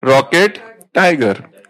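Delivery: one word per letter, each set apart; spelling by picture words. Shown as a demonstration. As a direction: spell it out like a phonics chart. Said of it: "ऐसे पढ़ना है".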